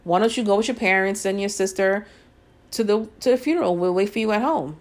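ordinary delivery

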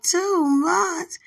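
high, loud, disbelieving "Two months?"; shocked. Retold or imitated. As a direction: gasp it out in shock. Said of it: "I was like".

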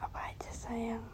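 soft intimate whisper into the mic. Real, not acted. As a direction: whisper it close, like a secret. Whispers to listener throughout. (berbisik lembut)

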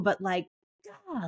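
ordinary talk

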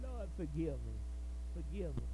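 pleading. Retold or imitated. David begging God's forgiveness in a hushed, broken voice. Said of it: "He cried out to the Lord".

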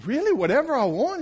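can't believe his luck; amazed guy. Acted. The beach guy answers the genie, high and amazed.

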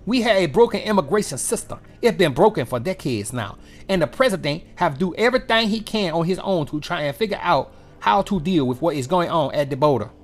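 put-on a sneering parody of her accent; mock Jean-Pierre accent. Host mocks press secretary, imitating her accent in a fast rush. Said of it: "that's my accent for her".

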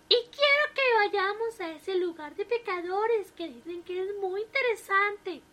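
put-on high, sweet old wife voice; a cheerful elderly wife greeting her husband; wife's voice. A narrator's reading. Wife voiced proposing trip.

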